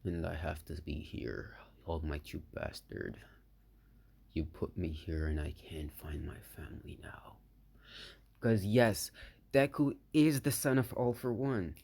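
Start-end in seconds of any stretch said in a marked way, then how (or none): 0.0-7.4 low, very soft resentment; hushed bitter thought. Acted. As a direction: nearly whisper it, as if thinking aloud. Acted homesick, bitter inner thought.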